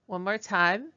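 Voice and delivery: ordinary talk, nothing marked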